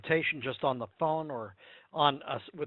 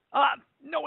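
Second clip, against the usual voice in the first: firm denial; 10 semitones higher, 5 dB louder than usual. Real, not acted. Emphatic rebuttal to objection.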